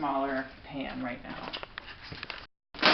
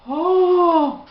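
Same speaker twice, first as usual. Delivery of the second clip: pained yelp; 13 semitones higher, 13 dB louder than usual. Real, not acted. Burning taste from peppermint extract.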